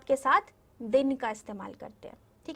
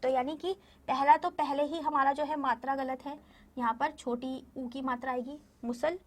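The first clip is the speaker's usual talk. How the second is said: ordinary talk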